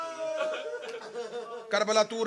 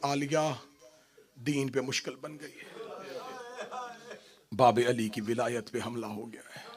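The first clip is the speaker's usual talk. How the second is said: soft, low, intimate storytelling; quiet grieving sermon voice; hushed recitation; 5 semitones lower, 12 dB quieter than usual. Acted. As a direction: draw listeners in close and quiet. Hushed night scene of siblings.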